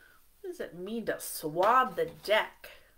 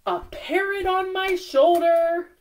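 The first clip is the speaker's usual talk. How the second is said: poem reading; 7 semitones higher, 5 dB louder than usual. Acted.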